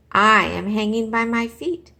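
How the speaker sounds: loud, emphatic correction; loud, certain protest; firm insistence. Acted. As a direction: set them straight loudly. Stella Luna insists.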